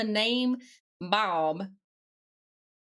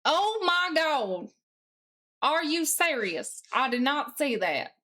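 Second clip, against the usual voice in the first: growing disbelief; 5 semitones higher than usual. Real, not acted. Discovers a hidden task file.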